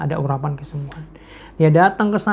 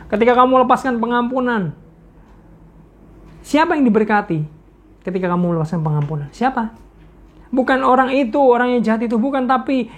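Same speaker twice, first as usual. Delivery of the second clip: preacher rousing the crowd about forgiveness; preaching at full force; 7 semitones higher than usual. Acted. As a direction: drive it home to the congregation. Preacher urging the congregation.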